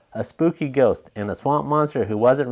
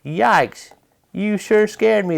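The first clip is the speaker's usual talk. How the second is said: mock fright; 7 semitones higher than usual. A narrator's reading. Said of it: "the swamp monster quacked".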